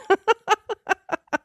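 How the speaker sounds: high, quick giggling burst; laughing. Real, not acted. Cracking up after calling someone rude, high laughter. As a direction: crack up at your own remark.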